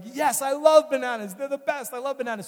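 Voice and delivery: happy patient gushing to his doctor; delighted patient. Retold or imitated. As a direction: play the patient thrilled about bananas. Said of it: "the patient was like".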